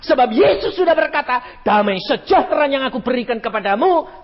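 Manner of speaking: loud preacher's cry to the church; preaching at full force. Acted. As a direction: proclaim it over the crowd.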